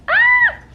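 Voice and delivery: high, loud squeal of joy; excited scream. Real, not acted. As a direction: shriek with delight. Thrilled about new apartment.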